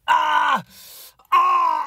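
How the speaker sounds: sharp high yelps of pain; brain freeze. Real, not acted. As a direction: cry out from the sudden cold ache. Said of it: "I got brain freeze!".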